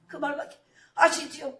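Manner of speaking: ordinary talk, nothing marked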